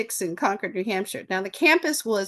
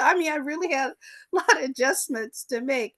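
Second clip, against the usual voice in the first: normal talking voice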